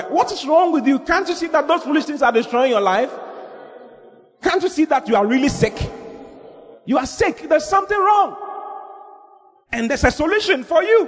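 high-pitched, urgent sermon delivery; impassioned preaching. Real, not acted. Preacher challenges congregation about foolish things destroying lives, impassioned. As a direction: plead with them from the pulpit, voice pitched high.

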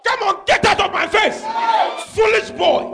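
high shouted scolding as God; God's angry dismissal. Pretend. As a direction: shout it out like God throwing someone out. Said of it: "That's what God will say to you".

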